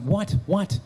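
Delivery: high-pitched, startled double question; retold alarm, voice jumping up. Baffled reaction to wife's alarm.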